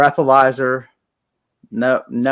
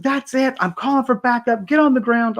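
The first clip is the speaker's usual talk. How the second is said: high, urgent commands to get down; cop taking charge; 13 semitones higher than usual. Acted. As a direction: cop calling in backup. Cop orders arrest in joke.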